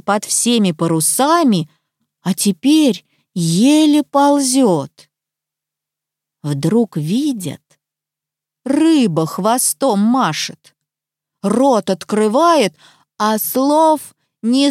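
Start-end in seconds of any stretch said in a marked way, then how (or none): none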